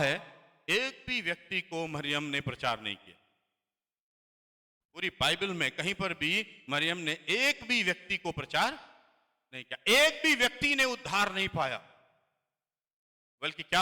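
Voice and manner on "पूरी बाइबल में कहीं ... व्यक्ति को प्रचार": ordinary delivery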